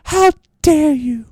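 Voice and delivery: shrill mock outrage, slowly; mocking Greta. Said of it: "It's a stupid voice".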